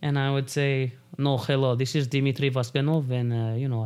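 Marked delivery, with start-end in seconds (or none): 1.2-3.1 put-on Russian accent; lightly hammed-up Russian accent. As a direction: answer as a Russian man. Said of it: "talk with a random Russian accent".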